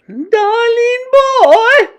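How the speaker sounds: very high, loud, drawn-out coo; syrupy witch calling out sweetly. A narrator's reading. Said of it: "cooed the Grand High Witch".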